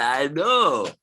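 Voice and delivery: high, loud acted cry; imitating a friend's delighted agreement; gleeful acknowledgement. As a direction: share the joke at the top of your voice. Replays the friend's gleeful reply.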